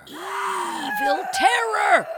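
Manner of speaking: slow booming evil voice; evil villain voice. Pretend. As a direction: boom it like a horror trailer. Mock spooky announcement.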